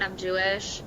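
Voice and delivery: usual speaking voice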